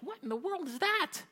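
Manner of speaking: high-pitched, appalled squeal; mock offended disbelief. Preacher mimics listeners offended by Jesus, high mock disbelief. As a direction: recoil in high, hammed-up disgust. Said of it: "it's suddenly offensive".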